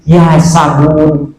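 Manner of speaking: long slow "Ya sabun" with a held "Ya"; slow drawn call. Shown as a demonstration. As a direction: draw out "Ya sabun" slowly, syllable by syllable. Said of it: "Bukan sabun cuci ini mah".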